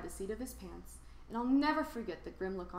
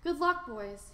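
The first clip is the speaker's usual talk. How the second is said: called out; 6 semitones higher, 4 dB louder, slower than usual. A narrator's reading. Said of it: "he cried".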